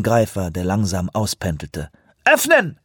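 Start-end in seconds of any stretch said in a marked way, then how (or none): 2.2-2.8 calling out. Acted. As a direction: bark the order across deck. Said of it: "rief sie".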